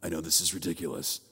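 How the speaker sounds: low, quick, hushed hero voice; Batman. Acted. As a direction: stay low in the mock superhero voice. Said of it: "I'd try to talk like Batman".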